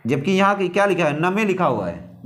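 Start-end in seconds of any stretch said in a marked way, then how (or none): 1.2-1.4 "नमे" in two syllables, na-me; Hindi-style literal reading. Shown as a demonstration. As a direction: read name letter by letter as "नमे". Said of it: "Hindi के हिसाब से पढ़ें". ("नमे", हिंदी के हिसाब से उच्चारण)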